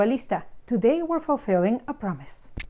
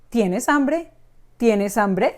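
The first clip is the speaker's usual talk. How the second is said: "Tienes hambre" with a rise on "hambre"; question intonation; slower than usual. Shown as a demonstration. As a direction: ask "Tienes hambre" with the end going up. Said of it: "one changes the intonation".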